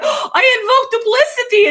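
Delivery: very high, excited in-character cry; Jester character voice. Retold or imitated. Cosplayer greeting other Jesters on the con floor, high character voice. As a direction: call it out high and loud as Jester. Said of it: "seeing other Jesters and be like".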